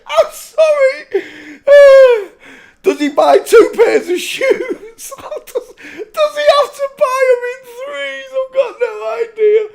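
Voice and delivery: squealing, tearful real laughter, very high; helpless laughing. Real, not acted. Host asking about shoes for three legs, laughing helplessly. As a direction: squeeze the questions out through laughter. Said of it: "I shouldn't be laughing".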